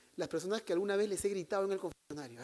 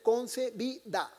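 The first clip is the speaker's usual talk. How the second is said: CONCEBIDA slowed and raised, each syllable separate; syllable-by-syllable drilling; 4 semitones higher, much slower than usual. Shown as a demonstration. Priest teaching how to respond, slow, deliberate CONCEBIDA. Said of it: "tienen que saber responder".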